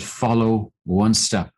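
slow, step by step; slow and steady guidance; slow guiding command. Retold or imitated. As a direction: lead her on slowly, one word at a time. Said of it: "He said".

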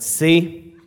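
low, slow impression of a stern father; stern dad. Stern dad lecturing his kids, low and deliberate. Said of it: "stern, and, and this impatient man".

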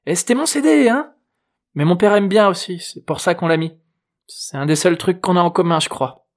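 put-on high teenage girl's voice; assertive teen girl. A narrator's reading. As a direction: speak up as a girl defending her music, then ease off. Narrator plays Juliette about her CD.

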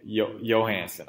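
"Johansson" begun with a Y sound; Y pronunciation. Real, not acted. Host corrects guest's name, "Johansson" with a Y. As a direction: say "Johansson" as "Yo-hansson", trying the first syllable first. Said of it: "try it with the Y".

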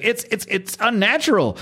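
high-pitched Southern character voice; Southern accent. Acted. Medical examiner horrified by a body, in a high Southern accent. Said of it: "why I'm giving him this accent".

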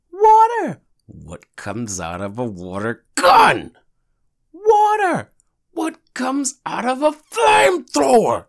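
high, loud outraged yelling, building to a scream; shrieking exasperation in a retold class argument; heated arguing. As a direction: argue it at full volume, stretching out each question. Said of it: "I'm arguing with the class".

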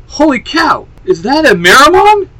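high, loud mock amazement; sarcastic shout. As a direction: yell it with heavy sarcasm. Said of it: "Kit shouts out very sarcastically".

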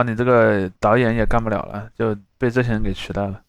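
usual speaking voice

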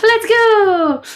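loud, high hype call of let's go; catchphrase shout. Acted. Host shouts the show's catchphrase, loud and hyped. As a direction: shout it out like a show opener. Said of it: "I don't know what my let's go was then".

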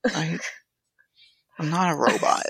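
ordinary talk

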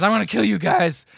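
loud, high threat; mock angry threat. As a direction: threaten them loudly, hammed up. Retelling [name]'s threat.

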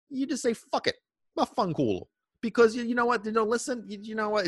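high, whiny imitation of a dog owner giving up; lightly mock the owner who gives up; mock fed-up owner. Mocking frustrated dog owner.

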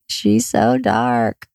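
low, slow, dazed murmur. Retold or imitated. Exhausted mother first seeing her baby, low and slow. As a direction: drift out the words, spent and slow. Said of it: "all you can hear me saying is".

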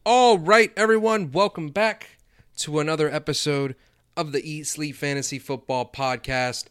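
loud, high show opener; big energetic podcast intro; hyped welcome. Real, not acted. Host opens the podcast.

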